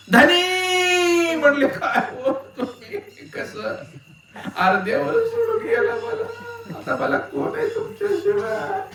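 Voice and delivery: high, stretched hammed-up wail of a widow; mock wailing widow. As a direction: wail over the husband like a mourner. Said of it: "बाईनं हंबरटा फोडला".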